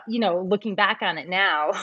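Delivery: normal talking voice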